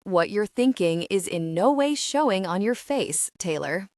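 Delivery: usual speaking voice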